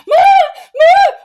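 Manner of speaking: very high, very loud shout of Mom; urgent child shout. Retold or imitated. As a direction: scream for mom like it's urgent.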